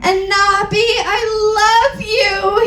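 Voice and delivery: high, slow, hammed-up sobbing cry; mock-tragic wail; sad shout. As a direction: howl the love confession dramatically. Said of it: "he shouted sadly".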